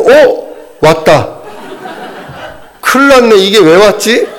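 startled, high, rising panic; hammed-up dread as sleep hits; mock alarm. Realizing drowsiness is coming.

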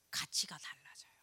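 a soft confiding whisper. Real, not acted. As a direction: lean in and whisper it. Intimate point whispered.

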